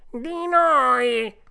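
loud, high cat wail; echoing cat. A narrator's reading. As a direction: echo the fox's plea at full volume. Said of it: "ripeté il gatto". (gatto che fa eco, forte e acuto)

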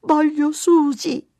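high, loud, drawn-out wail; child's shout. A narrator's reading. Mary demanding her lost dog, shouting. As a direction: cry out like a distraught little girl. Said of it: "gridò Mary".

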